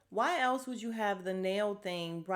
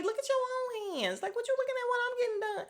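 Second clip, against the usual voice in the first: much higher, fast, put-on voice; fast high mock snap; snippy clapback. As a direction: tell the nosy neighbour off. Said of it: "And I'll be like".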